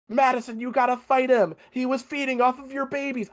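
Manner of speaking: high, urgent mock yell; mock screaming. Sister urging Madison to fight him, mock screaming. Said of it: "she's screaming it at Madison".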